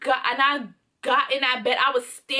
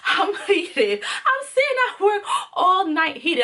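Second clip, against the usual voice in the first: retold fury; 6 semitones higher than usual. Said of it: "I am furious".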